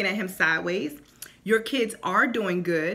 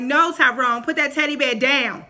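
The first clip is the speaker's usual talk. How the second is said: louder stern mom command; firm mom voice; 4 semitones higher, 7 dB louder than usual. Retold or imitated. Mother scolding her son Tyrone, firm and louder. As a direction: lay down the law to the child. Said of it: "put your bass in your voice".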